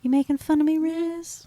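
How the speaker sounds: high, small mock-Sandy voice; high-pitched wounded-girl imitation; quiet-voice impression. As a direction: quote it in a tiny, high voice. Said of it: "like, a really quiet voice".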